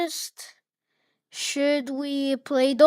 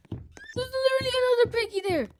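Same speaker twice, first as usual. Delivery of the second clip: high, fast, panicked squeal; alarmed squeal; 11 semitones higher, 5 dB quieter, faster than usual. Real, not acted. Warning viewers of another piggy, panicked squeal. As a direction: rush it out high-pitched in alarm.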